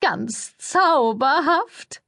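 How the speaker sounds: very slow, high, drawn-out gush; high gushy publicist; gushing praise. A narrator's reading. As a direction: stretch it out like fake delight. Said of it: "sagte sie".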